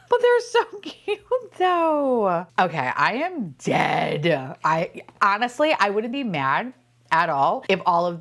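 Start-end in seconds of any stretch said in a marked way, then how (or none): 0.1-2.5 high cooing whine, falling at the end; high-pitched adoring wail; gushing whine. Real, not acted. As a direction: plead how adorable they are. Cooing over toys.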